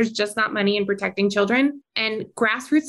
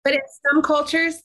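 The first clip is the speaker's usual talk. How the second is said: sarcastic; 6 semitones higher than usual. Pretend. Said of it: "That was me sarcastic".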